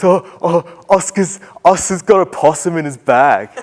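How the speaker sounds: a hint of an excited schoolboy; excited boy. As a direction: blurt it out like a tattling kid. Boy voiced in story.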